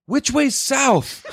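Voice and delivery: very high, drawn-out mock voice; slow squeaked question, mocking a stranger; clueless homeless man.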